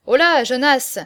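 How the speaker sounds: loud hail across a crowd; calling out. A narrator's reading. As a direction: shout the name over the noise. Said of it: "criait-on de toutes parts".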